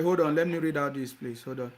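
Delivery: ordinary talk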